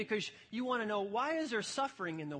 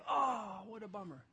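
mock sympathy. Shown as a demonstration. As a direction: overdo the commiseration, dropping to a hush. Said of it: "If I say it that way".

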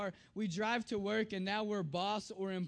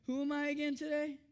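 put-on echoed child's question; 5 semitones higher than usual. Echoes the daughter's question for adults.

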